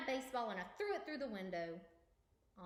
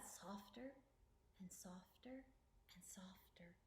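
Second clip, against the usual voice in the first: softer and softer down to a whisper; fading to a whisper; 14 dB quieter than usual. A narrator's reading. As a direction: let the voice die away like the gong. Gong fading away.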